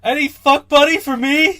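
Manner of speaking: high, loud, slow put-on voice for the message; mock needy fan. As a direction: read it like a desperate fan begging. Reading out a listener's message.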